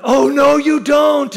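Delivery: high, loud mock shout; wife shouting. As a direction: yell it like a wife who knows the truth. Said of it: "my wife was like".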